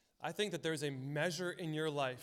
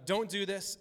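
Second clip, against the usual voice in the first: raised, louder parental order; retold scolding; 4 semitones higher, 5 dB louder than usual. Parent telling five and two-year-old off, lightly acted scolding. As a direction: act out a firm telling-off.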